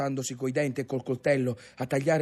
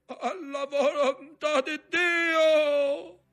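high, drawn-out shouted order; skipper's storm cry; much slower than usual. A narrator's reading. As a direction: cry it out long over the wind. (grido acuto e prolungato nella burrasca)

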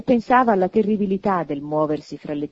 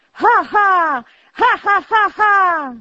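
loud, high, rolling ha-ha; uproarious laughter; 13 semitones higher, 9 dB louder, much slower than usual. A narrator's reading. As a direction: roar with acted laughter. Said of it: "ridevano sgangheratamente".

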